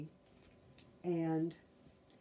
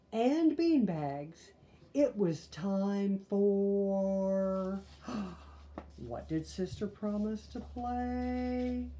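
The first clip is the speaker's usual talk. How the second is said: slow, loud drawn-out buildup; dramatic storyteller buildup; building suspense; 6 semitones higher, 5 dB louder, much slower than usual. Acted. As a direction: stretch it out for the reveal.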